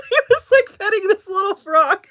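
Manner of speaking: high, loud words breaking up with laughter; laughing hard. Real, not acted. Telling how he petted a clay frog, laughing hard. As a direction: barely get it out for laughing.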